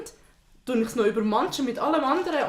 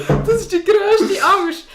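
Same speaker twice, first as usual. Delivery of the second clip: mock dramatic; 9 semitones higher, 10 dB louder, much slower than usual. Real, not acted. Dramatic fear of cat food.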